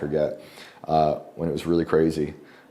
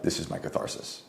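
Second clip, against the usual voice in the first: hushed whisper; 2 semitones lower, 10 dB quieter than usual. Retold or imitated. Retold inner thought, hushed.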